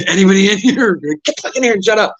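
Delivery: high frantic call; panicked. Retold or imitated. Elmer running in from the cops, high and frantic. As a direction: rush it, panicked whisper-shout. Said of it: "Elmer comes running by".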